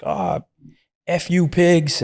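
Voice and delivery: mock the kid cursing the cops from the window; drugged-up kid's high, angry yell. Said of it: "and he's like".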